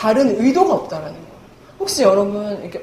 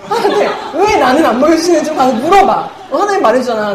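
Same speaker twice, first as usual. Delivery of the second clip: louder mock-exasperated demand; retold whiny insistence, pushed louder; annoyed pleading; 5 semitones higher, 5 dB louder than usual. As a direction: imitate a friend pestering for a favour. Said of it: "막 짜증을 막 내는 거예요".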